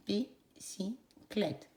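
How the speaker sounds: Bicyclette drawn out in three slow beats; syllable by syllable. Shown as a demonstration. Teacher repeating the word for children, slowly. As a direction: clap out Bicyclette one beat each. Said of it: "Trois syllabes".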